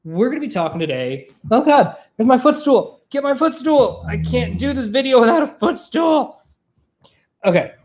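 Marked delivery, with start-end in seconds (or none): 1.5-6.4 high, hammed-up fuss over a trifle; mock diva demand. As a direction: play up the desperate demand, pitched high. Mock panic over missing footstool.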